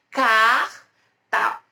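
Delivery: "carta" dividido em car e ta, com pausa entre as duas; sílabas separadas. Shown as a demonstration. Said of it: "quando a gente vai separar as sílabas". ("carta" pronunciado sílaba por sílaba)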